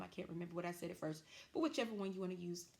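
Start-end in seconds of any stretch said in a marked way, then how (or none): none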